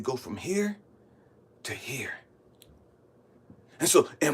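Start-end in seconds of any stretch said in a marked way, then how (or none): 0.0-2.1 low, soft voice of God; God instructing. Retold or imitated. God directing Elijah from one point to another, quietly. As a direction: give a quiet, measured instruction. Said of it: "God said".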